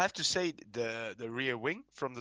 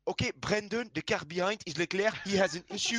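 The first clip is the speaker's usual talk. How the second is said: lightly put-on French accent, a touch higher; 4 semitones higher, 3 dB louder than usual. Race engineer radioing Brendan about Leclerc, French accent. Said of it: "With the French accent?".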